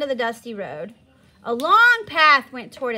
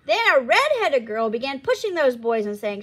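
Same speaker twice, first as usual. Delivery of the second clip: plain reading voice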